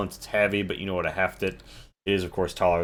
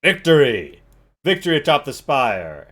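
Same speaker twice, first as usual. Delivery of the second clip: loud triumphant call, higher; a commander's triumphant rallying cry; cries out; 6 semitones higher, 7 dB louder than usual. Acted. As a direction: shout victory to the crowd. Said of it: "she cries out".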